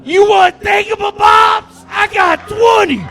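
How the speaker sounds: high, loud shrieked cartoon voice; squealing mermaid impression.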